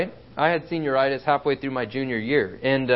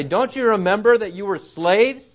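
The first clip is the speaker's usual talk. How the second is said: higher-pitched incredulous question; exasperated challenge; 6 semitones higher, 4 dB louder than usual. Acted. Preacher challenging a forgetful Hebrew, exasperated. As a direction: confront them as if grabbing them to shake their memory. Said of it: "grab a Hebrew at this point and say".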